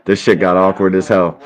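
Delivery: ordinary delivery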